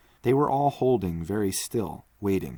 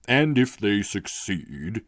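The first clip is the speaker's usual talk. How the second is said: slow, lightly voiced lonely magician; a touch of a worried old magician; magician's voice; 2 semitones higher, 4 dB louder, slower than usual. Magician speaks in his cell.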